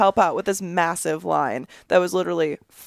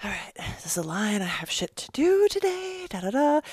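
whispering; 5 dB quieter than usual. Retold or imitated. Thinking aloud, annoyed in line.

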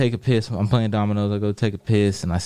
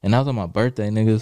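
ordinary delivery

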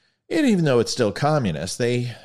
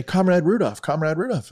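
higher-pitched hail to an officer; calling out; 8 semitones higher than usual. Acted. Tourist couple hailing their Russian officer in a joke, calling out. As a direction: call out eagerly for someone's attention.

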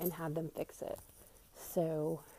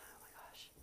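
exhausted whisper; 18 dB quieter, faster than usual. Real, not acted. Exhausted exhale while cleaning.

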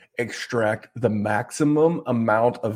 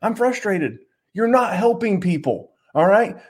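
frustrated; 8 semitones higher than usual. Real, not acted. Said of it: "I'm mad".

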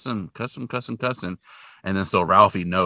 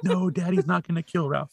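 higher imitated mom voice; motherly reassurance in raised pitch; reassuring mother; 8 semitones higher than usual. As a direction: soothe a little child, lightly acted. Mother voiced soothing crying child.

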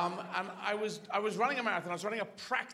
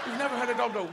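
ordinary talk

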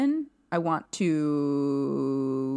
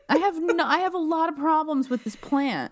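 much higher, incredulous squeak; baffled high voice; 10 semitones higher than usual. Acted. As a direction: squeak it out in disbelief. Mocking the plant in the lab.

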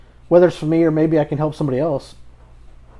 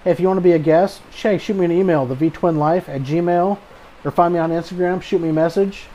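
podcast outro; 2 semitones higher, 3 dB louder than usual. Acted. Host reads podcast outro.

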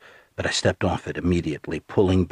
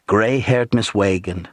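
plain reading voice

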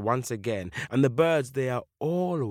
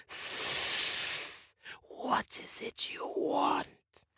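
slow, whispered snake voice; long hiss, then a drawn-out whisper; much slower than usual. Acted. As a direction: whisper like a huge serpent. Snake king hisses its question.

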